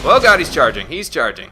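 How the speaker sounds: loud alarmed cry; panicked shout. Real, not acted. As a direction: yell it as the enemy charges. Krogan charging at player.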